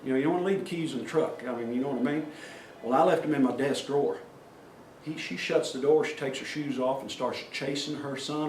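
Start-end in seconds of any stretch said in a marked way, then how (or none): none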